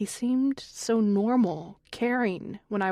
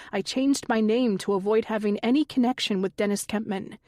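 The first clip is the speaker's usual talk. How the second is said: usual speaking voice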